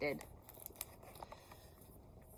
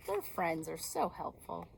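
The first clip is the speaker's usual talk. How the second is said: ordinary delivery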